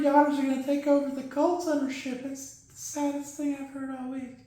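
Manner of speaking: soft, high, somber puppet voice; Ziggy the Zebra. Acted. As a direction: mourn quietly as a sad cartoon mascot. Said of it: "glad you were somber for that".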